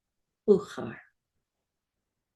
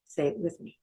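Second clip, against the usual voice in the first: usual speaking voice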